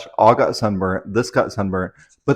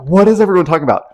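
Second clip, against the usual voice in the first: quick, higher, louder confused question; confused retelling; 8 semitones higher, 5 dB louder, much faster than usual. Retold or imitated. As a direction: rattle off the baffled question. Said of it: "And I'm like".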